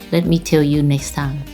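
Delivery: ordinary talk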